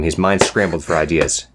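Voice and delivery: plain reading voice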